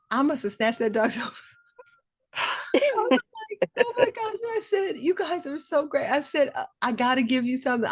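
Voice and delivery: high, excited, gushing thanks; thanking the neighbors who found her dog, overjoyed. Real, not acted. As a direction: pour out gratitude, voice up high.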